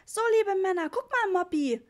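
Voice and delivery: put-on high, loud child's call; thrilled kid showing off to a sibling; excited little boy. A narrator's reading. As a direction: call out in high-pitched delight. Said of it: "rief Ritchie aufgeregt".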